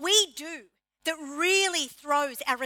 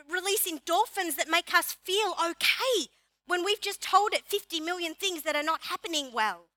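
ordinary talk

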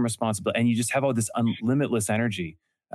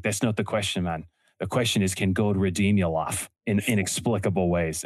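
imitating an Australian pastor's accent; put-on Australian accent; 2 semitones lower than usual. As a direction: say it like an Australian, man. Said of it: "Is that beautiful Australian accent".

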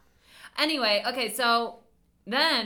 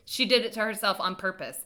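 ordinary delivery

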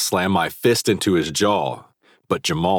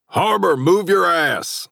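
loud, slow coach's call across the field; 13 semitones higher, 4 dB louder, slower than usual. A narrator's reading. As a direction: bellow it from the sidelines. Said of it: "Coach Carroll called from the sidelines".

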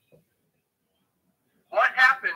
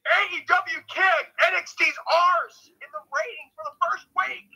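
excited gloating; 11 semitones higher, 5 dB louder than usual. Real, not acted. Gloating about the ratings win.

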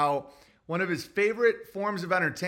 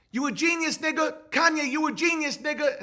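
imitating [name]; 9 semitones higher than usual. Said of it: "[name]'s been".

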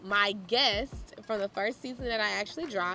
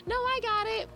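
pitched way up, brushing off help; mock independent refusal; 13 semitones higher than usual. To the man at Sam's Club, a high proud brush-off. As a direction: insist you've got it, a little too proud. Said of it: "Miss Little Independent".

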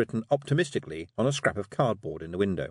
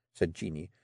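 usual speaking voice

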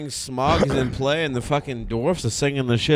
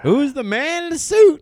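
much higher and louder, squealing admirer; high mock swoon; 6 dB louder than usual. Friend playing a girl swooning over the officiant, high squeal. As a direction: squeal "Who's the man in the suit?" like a smitten fan.

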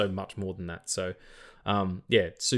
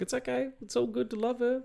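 reassuring; 12 semitones higher, 3 dB quieter than usual. Retold or imitated. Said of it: "I was like".

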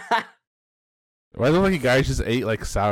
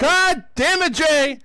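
high playful outburst; mock angry yell; 12 semitones higher, 4 dB louder, slower than usual. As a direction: fake frustrated shout.

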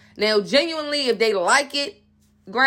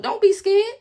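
high reassuring; 10 semitones higher than usual. Acted.

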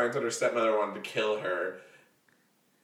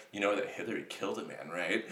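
quick, softer, low-voiced secret; conspiratorial hush; 6 semitones lower, 8 dB quieter than usual. Uncle leaning in to share a conspiracy, hushed. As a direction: lean in and whisper the rumour. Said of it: "It sounded like one of those".

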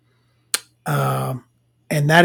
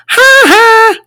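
high, loud two-note "ha ha"; Simpsons character's taunt; 10 dB louder than usual. Retold or imitated. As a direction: imitate a cartoon bully's mocking laugh. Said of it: "forgive me if I can't do this voice".

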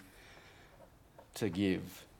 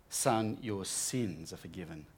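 gentle forgiving; 6 semitones lower, 9 dB quieter than usual. Retold or imitated. Jesus speaks to paralyzed man.

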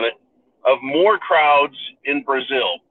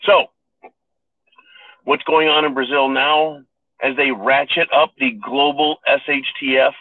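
ordinary talk, nothing marked

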